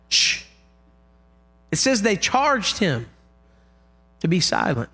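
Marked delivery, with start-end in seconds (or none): 0.1-0.4 sharp retold "shh"; shushing. Disciples silencing the blind man, shushing. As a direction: shush him with a hiss.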